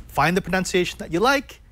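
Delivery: usual speaking voice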